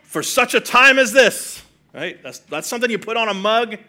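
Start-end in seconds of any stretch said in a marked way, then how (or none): 0.0-1.6 raised, slow, grand delivery of a famous line; hammed-up epic movie quote; dramatic movie line. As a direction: say it like a film trailer. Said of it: "I got the movie lines".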